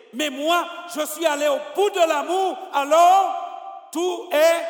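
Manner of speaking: loud, high preaching, slowing hard on alors tout est; preaching at full force. Acted. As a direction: drive it home to the congregation. Preacher builds to climax.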